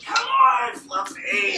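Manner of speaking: high loud coaxing call; impatient owner. Retold or imitated. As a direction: owner tugging the dog along. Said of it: "And then the owner's like".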